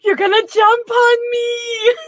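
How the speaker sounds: very high, drawn-out hysterical shriek; hammed-up panicked wail; freaking out. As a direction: scream it in comic hysteria. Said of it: "he's just freaking out".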